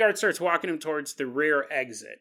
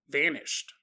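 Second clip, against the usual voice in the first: usual speaking voice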